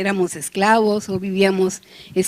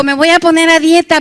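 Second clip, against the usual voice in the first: higher and louder, grandly determined; preacher mocking his own resolution; mock resolve; 6 semitones higher, 6 dB louder than usual. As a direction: announce the diet like a solemn vow. Mock resolution about dieting.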